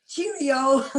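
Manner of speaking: high, loud Scottish cheerio; playful imitation of a Scottish greeting; Scottish accent. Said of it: "come out with a Scottish accent and go".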